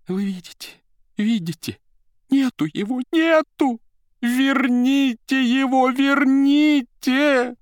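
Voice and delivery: high shrill wail, building and stretching out the last pleas; the secretary shrieks for her boss back, wringing her hands; shrieking cry. A narrator's reading. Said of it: "взвизгнула ... вскричала".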